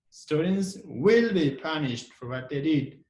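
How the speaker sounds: slow sentence with "will" stretched and stressed. Shown as a demonstration. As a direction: lean long on "will". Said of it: "we put a stress on the will".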